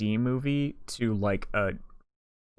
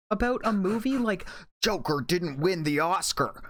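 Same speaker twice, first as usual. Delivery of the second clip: mocking outrage; 7 semitones higher than usual. Mocking Joker fans' outrage.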